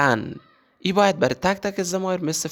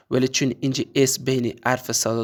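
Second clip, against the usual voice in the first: normal talking voice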